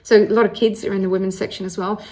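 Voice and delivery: plain reading voice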